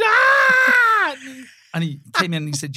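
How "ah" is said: high, loud mock scream of fright. Woman chased into station, loud high mock scream. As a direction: let out a hammed-up terrified scream.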